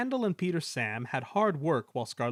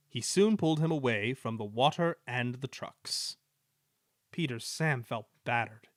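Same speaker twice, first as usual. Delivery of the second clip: ordinary delivery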